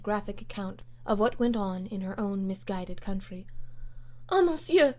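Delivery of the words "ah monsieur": high, loud anguished cry; distressed Frenchwoman. Acted. The Comtesse cries out to Lord Grenville, high and loud. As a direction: cry out in dismay. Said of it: "she said in broken English".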